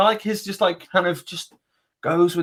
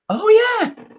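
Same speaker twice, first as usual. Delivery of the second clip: sheepish realisation; 3 dB louder than usual. Retold or imitated. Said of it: "I was like".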